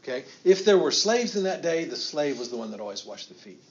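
ordinary talk

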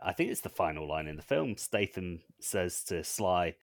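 ordinary delivery

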